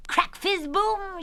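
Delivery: high, slow, drawn-out explosion words; shouted firework noises. Acted. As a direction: yell it like fireworks bursting in turn. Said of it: "shouted Kathy".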